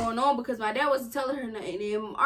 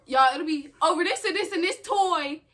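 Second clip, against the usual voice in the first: usual speaking voice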